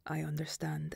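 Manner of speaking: quiet menace in a whisper; cold whisper. Acted. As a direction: drop to a calm whisper. Mother answers coldly.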